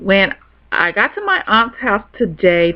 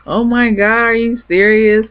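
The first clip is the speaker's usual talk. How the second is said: gasping, higher-pitched disbelief; retold excitement; 4 semitones higher, 3 dB louder than usual. Cousin hearing the pregnancy secret, imitated excited shock. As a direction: act out an excited, stretched-out reaction. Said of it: "like, excited and couldn't talk".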